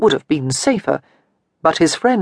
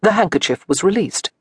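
usual speaking voice

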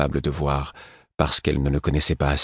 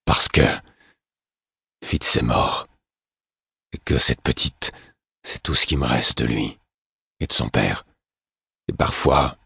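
much lower, hoarse and grieving; hoarse grieving man; 6 semitones lower than usual. A narrator's reading. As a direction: rasp out the grief. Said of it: "d'une voix rauque qu'il répondit".

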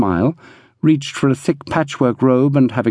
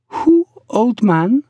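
put-on high, slow accented woman's voice; questioning Pearl; 11 semitones higher, slower than usual. A narrator's reading. Pearl asking who the old man is, questioning. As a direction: ask it high and drawn out.

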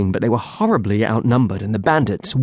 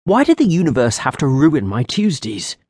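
plain reading voice

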